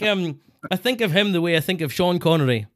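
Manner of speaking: ordinary talk, nothing marked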